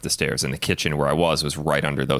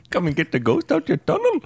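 high, badly faked Indian accent; mock Indian accent, hammed up; 13 semitones higher than usual. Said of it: "that's the wrong accent".

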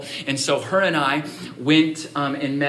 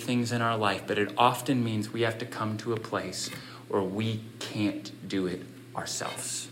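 lower, softer sincere preaching; soft reflective sermon; 4 semitones lower, 8 dB quieter than usual. Acted. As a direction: draw them in quietly.

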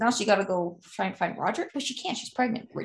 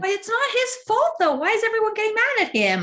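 much higher pleading objection; high-pitched protest; 10 semitones higher than usual. Real, not acted. Listener defending blamed character to storyteller, high protesting plea.

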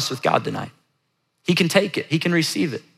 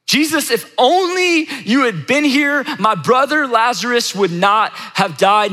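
high, drawn-out anguished plea; acted-out lament, slow and high; emotional, not calm; 8 semitones higher, slower than usual. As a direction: stretch the words in a raised, pleading reproach. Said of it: "in not a super calm tone".

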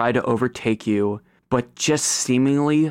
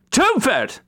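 high, sharp summons; calling out; 13 semitones higher, 3 dB louder than usual. Acted. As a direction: call the servant from across a crowd. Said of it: "she calls out".

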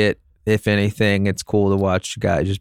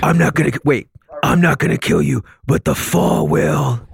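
higher, louder hammed-up hero line; mock Batman threat; 6 semitones higher, 3 dB louder than usual. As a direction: deliver it like a cheesy action hero. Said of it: "Robert Pattinson was like".

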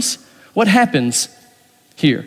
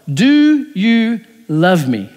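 "do you love me" drawn out very slowly; a slow, searching plea from Jesus to Peter; slow pleading question; much slower than usual. Acted. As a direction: let each word hang, asking gently. Jesus asks Peter a second time.

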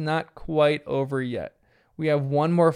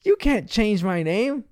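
higher, slow mock protest; protesting dad; 5 semitones higher, slower than usual. Bandit protests to his teasing girls, mock indignant. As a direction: act out a dad objecting. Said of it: "The girls then tease Bandit back".

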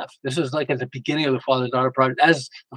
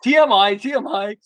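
mock exasperated son; 7 semitones higher, 4 dB louder than usual. Imitating son's exasperated protest.

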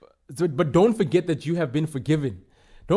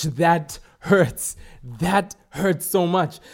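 slow emphatic; much slower than usual. Acted. Preacher dwells on painful parable.

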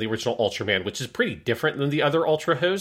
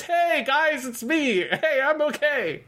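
very high, slow, carefree hail from the reeds; cheery distant call; slower than usual. Retold or imitated. Imitating Hayata emerging from the reeds, high cheery call. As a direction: wave everyone over, all innocent.

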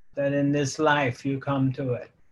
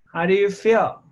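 ordinary talk, nothing marked

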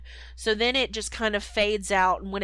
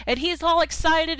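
ordinary delivery

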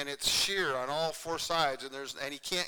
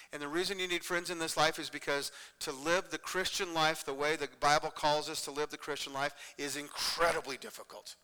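normal talking voice

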